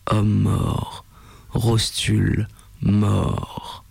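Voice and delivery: low, slow growl; slow, low rumble; creature growling. A narrator's reading. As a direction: growl it like a heavy beast. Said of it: "gronda la lourde créature".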